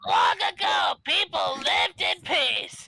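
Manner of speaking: put-on old woman voice. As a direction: read it like a frail old lady. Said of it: "in my old woman voice".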